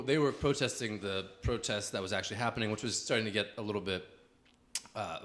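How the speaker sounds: ordinary talk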